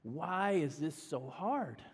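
slow, soft, worn-out sigh of a question; weary lament. Retold or imitated. Parents in the living room at night asking, slow and worn out. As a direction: let the question sag with tiredness. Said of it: "we're going".

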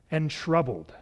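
usual speaking voice

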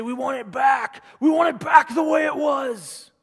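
furious demand to turn the clock back; angry shout. Retold or imitated. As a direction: yell it out in anger. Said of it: "lash out and be angry and say".

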